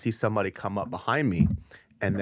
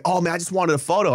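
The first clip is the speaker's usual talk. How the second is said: whiny fan; 8 semitones higher, 4 dB louder than usual. Retold or imitated. Imitating fan whining.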